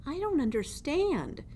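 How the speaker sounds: small timid mouse. Acted. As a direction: ask meekly from inside the fist. Mouse confused.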